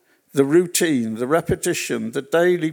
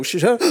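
retold gasp; 4 semitones higher, 3 dB louder than usual. Retelling the moment she woke.

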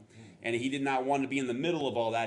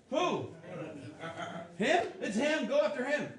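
panicked; 10 semitones higher than usual. Retold or imitated. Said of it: "I'd be like".

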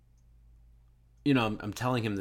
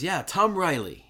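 high, loud imitation of Tom; Tom answering; 9 semitones higher, 5 dB louder than usual. Tom answering the call, lightly voiced, higher and louder. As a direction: play Tom, turning back brightly. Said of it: "he goes".

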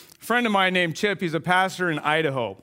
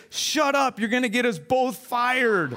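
raised pitch, slowing on the punchline; urgent voice from the lion; 6 semitones higher, slower than usual. Acted. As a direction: scold a panicking co-worker in character. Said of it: "this voice says".

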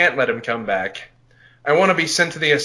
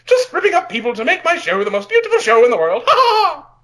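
much higher and louder, laughing through it into a cackle; maniacal villain boast; 14 semitones higher, 3 dB louder than usual. Acted. Villain boasting about his show, cackling madly. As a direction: gloat like a mad showman.

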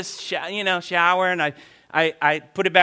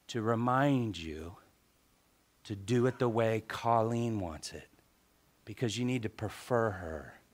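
hushed, unhurried, lower voice with pauses; still quiet voice; 6 semitones lower, 7 dB quieter than usual. Acted. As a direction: counsel gently as the voice of God. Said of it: "that still quiet voice said".

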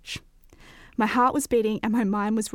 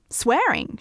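higher, emphatic exclamation; exclaiming; 5 semitones higher, 4 dB louder than usual. A narrator's reading. Friend explains her idea to me, exclaiming. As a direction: exclaim it with a lift. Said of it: "she exclaimed".

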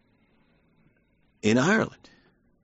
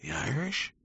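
softer, rising, baffled; puzzled question; 5 semitones higher, 9 dB quieter than usual. Retold or imitated. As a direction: ask it as if you can't believe it. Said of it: "And you're like".

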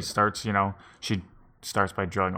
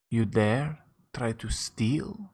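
put-on menacing old woman; slower than usual. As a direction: hiss a slow warning as the seer. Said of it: "she says".

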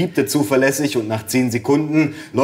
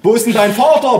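put-on high, loud old man's question; bellowing grandpa; 10 semitones higher, 5 dB louder than usual. A narrator's reading. Deaf grandpa asking after father, bellowing. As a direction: holler it as if half deaf. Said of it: "brüllte mein Opa".

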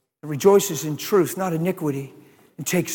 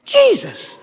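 much higher and louder, rising fervor; excited preaching; 12 semitones higher, 9 dB louder than usual. Real, not acted.

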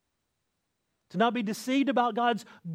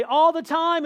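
shouted response; 10 semitones higher, 8 dB louder than usual. Retold or imitated. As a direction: shout the answer back as a crowd. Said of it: "they would say".

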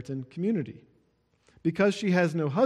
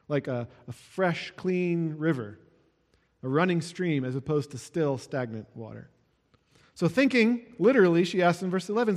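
ordinary talk, nothing marked

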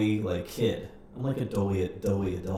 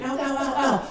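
rising yelps of mock pain, climbing much higher; a young wrestler faking injury mid-match, hammed-up ows; fake pained cries; faster than usual. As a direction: ham up getting hurt to fool an opponent. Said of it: "I did a lot of fake crying".

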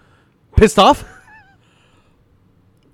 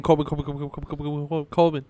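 quick, low-key run of the name, lower and softer; less high-pitched imitation; 3 semitones lower, 5 dB quieter than usual. Co-host correcting the impression of the film character, not so high-pitched. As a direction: rattle off the name without yelling it. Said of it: "it's not as high-pitched as when he's yelling".

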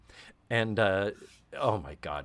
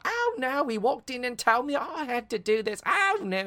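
mock Cockney, much higher; Cockney squeal; 12 semitones higher than usual. Host imitating Jane Leeves' Cockney maid. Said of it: "another Cockney".

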